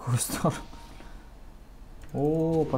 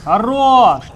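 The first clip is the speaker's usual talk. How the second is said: loud high cheer; triumphant shout; 9 semitones higher, 15 dB louder than usual. Real, not acted. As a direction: yell it in triumph. Cheering a good play.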